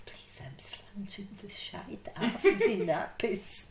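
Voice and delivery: whispered through laughter; giggling whisper of the copied Glasgow phrase; practiced Glasgow way. Shown as a demonstration. New nurse repeating the Scottish words to the supervisor, laughing whisper. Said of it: "the way she said it".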